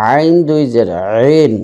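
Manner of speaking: আইন and রিন squeezed from mid-throat, drawn out; throat-pressed Ain. Shown as a demonstration. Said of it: "চাপ দিয়ে উচ্চারণ".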